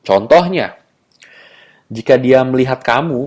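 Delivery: usual speaking voice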